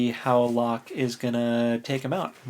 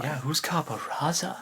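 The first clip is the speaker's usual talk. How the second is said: mock whisper; 4 semitones higher, 5 dB quieter than usual. Mock mysterious whisper about villain.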